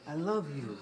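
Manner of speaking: usual speaking voice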